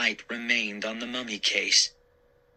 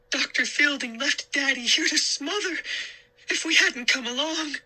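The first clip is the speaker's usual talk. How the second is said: put-on girl voice. A narrator's reading. Sari voiced, upset.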